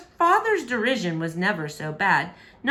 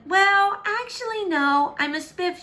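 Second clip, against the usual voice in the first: calling up; 11 semitones higher than usual. Acted. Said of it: "she called up from the gully below".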